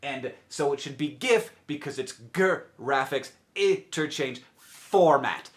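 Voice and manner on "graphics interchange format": "graphics interchange format" with each initial sound pulled apart; first letters stressed. Shown as a demonstration. Arguing from the acronym's letters, "graphics interchange format" with initials stressed. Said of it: "how the first letters in those things are pronounced".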